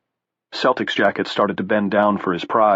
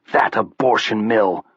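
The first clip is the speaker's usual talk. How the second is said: spat; slower than usual. Acted. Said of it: "she spat".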